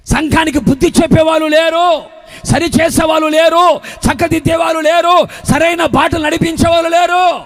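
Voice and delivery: high, driving sermon delivery; full-force preaching. Real, not acted. Preacher rousing the church about the foundation, full-force preaching.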